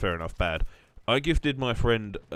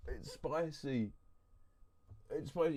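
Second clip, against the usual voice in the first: soft, high, strained whimper; eyes stinging; 6 semitones higher, 10 dB quieter, slower than usual. Real, not acted. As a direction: whimper quietly through the sting. Eyes burning from vapor rub.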